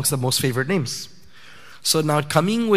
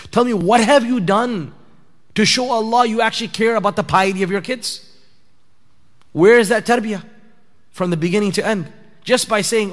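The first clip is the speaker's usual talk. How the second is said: raised, higher-pitched sermon challenge; forceful preaching; 4 semitones higher than usual. Real, not acted. Preacher challenging parents about empty duas, forceful exhortation. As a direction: press the congregation hard for an answer.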